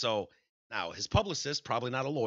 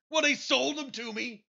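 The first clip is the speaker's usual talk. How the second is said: much higher, cocky put-on voice; mock defiant celebrity; 13 semitones higher than usual. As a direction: sneer it like a smug star brushing it off. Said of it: "What do you say to that, Mister Kelly?".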